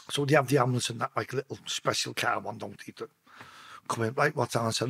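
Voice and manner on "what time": ordinary talk, nothing marked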